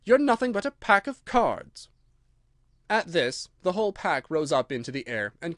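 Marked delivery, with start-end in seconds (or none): 0.0-1.9 high, scornful exclamation; contemptuous girl's outburst; defiant. A narrator's reading. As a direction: put the whole court down boldly. Alice defies the court.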